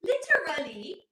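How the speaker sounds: exaggerated, slow influencer "literally"; asshole influencer. Shown as a demonstration. Mocking own delivery to viewers, affected influencer drawl. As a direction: draw out every syllable of "literally", affectedly. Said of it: "an asshole influencer".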